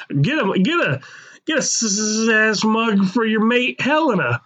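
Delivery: mock British ad voice; hammed-up British advert pitch; British accent.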